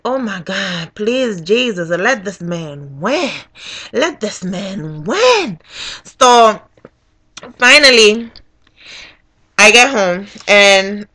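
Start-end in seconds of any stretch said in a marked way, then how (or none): 0.0-5.6 soft, slow begging; pleading prayer. Retold or imitated. Voter praying for her candidate, pleading softly. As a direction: pray it under your breath, stretching words. Retold prayer for Obama to win.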